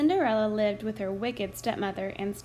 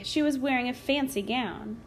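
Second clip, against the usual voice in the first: usual speaking voice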